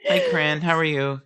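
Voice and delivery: sing-out hello, up high; high-pitched fond greeting. Real, not acted. Host says hello to Cran, high-pitched fond greeting.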